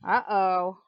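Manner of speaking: ordinary delivery, nothing marked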